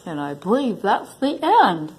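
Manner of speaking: plain reading voice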